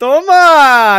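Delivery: very loud, high ta-da; triumphant shout. Pretend. Taking the costume off before the shopkeeper, triumphant yell. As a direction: yell it as a big reveal.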